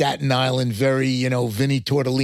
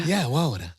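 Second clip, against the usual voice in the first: put-on Georgia accent; 4 dB quieter than usual. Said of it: "if you're from Georgia".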